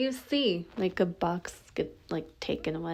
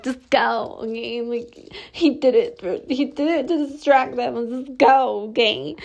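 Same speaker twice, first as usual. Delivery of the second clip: mock urgent plea; 5 semitones higher, 7 dB louder than usual. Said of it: "Yeah, you're just like".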